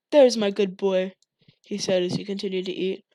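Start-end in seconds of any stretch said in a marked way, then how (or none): 0.1-1.1 loud, slow drawn-out father; father praising cowed son; menacing praise. A narrator's reading. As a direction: praise him with threat.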